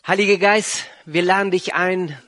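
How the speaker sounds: usual speaking voice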